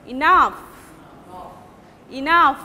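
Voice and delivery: "Enough" with a strong stress on NOUGH; light first syllable, punched second in "Enough"; primary stress. Shown as a demonstration. Teacher modelling primary stress, hitting NOUGH in "Enough". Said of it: "There is primary stress".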